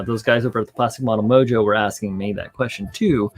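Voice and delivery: ordinary talk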